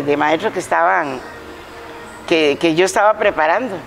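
normal talking voice

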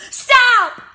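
very high, very loud scream; panicked kid screaming at the driver. A narrator's reading. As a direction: scream it to halt the bus. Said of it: "I shouted".

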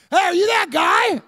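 high, loud mock yell; shouting stranger. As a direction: holler at a celebrity across the room. Stranger accosts him, acted.

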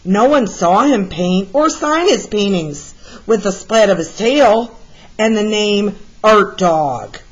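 ordinary delivery

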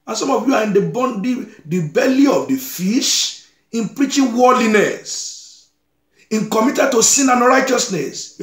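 high-pitched, insistent pulpit admonition; forceful preaching rebuke. Real, not acted. Preacher admonishing worldly congregation, forceful high-pitched rebuke.

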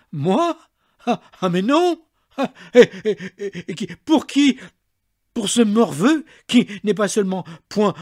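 shrill, very high and loud spluttering fury; trembling enraged maid. A narrator's reading. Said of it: "la voix tremblante".

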